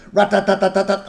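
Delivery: loud, high rattling gunfire; spray the platoon playfully; mock machine gun. Imaginary Tommy gun.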